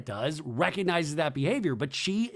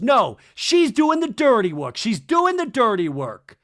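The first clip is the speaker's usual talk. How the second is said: loud, high, slow emphatic protest; mock angry accusation; 7 semitones higher, 7 dB louder, slower than usual. [name] at the table accusing Kristen, mock angry. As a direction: hammer out the accusation, repeating it loudly.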